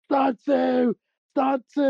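high retold alarm shout. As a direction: call it out like raising the alarm at night. Retelling the alarm call.

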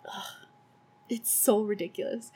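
ordinary talk

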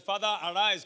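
loud call to father; Esau calling. Acted. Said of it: "And he said".

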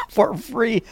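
laughing through the words; giggling disbelief. Real, not acted. Laughing at free baby.

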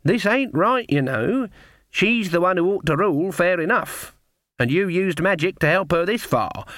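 lightly voiced stern granny; Granny, firm and reasoning.

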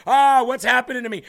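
lost wanderer crying out in fear; scared cry. Retold or imitated. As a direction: call out in alarm, lost and afraid. Lost sheep cries out, scared.

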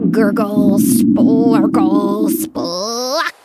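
loud, bubbling gurgle noises; stomach gurgle. Acted. Hungry stomach protesting to Fig with a loud gurgle. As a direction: gurgle it out like a churning belly. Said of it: "his stomach protested".

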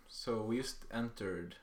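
normal talking voice

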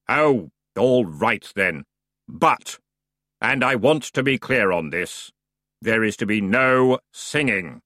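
slow, dry, weary boatman; bored underworld ferryman; grim ferryman voice. Acted.

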